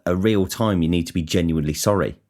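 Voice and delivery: ordinary delivery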